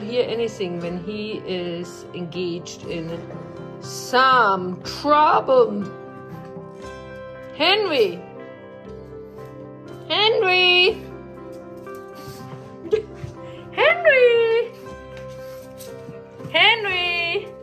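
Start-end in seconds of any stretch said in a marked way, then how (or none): 3.8-5.8 loud, very slow emphasis on "some trouble"; emphatic drawn-out words. Real, not acted. Pointed remark about deaf-acting dog. 7.6-17.5 high, loud, drawn-out name call; calling the dog. Real, not acted. Calling her dog repeatedly.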